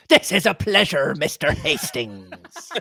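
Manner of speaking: gruff, slow pirate greeting. Acted. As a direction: growl it like a pirate captain. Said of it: "my go-to pirate voice".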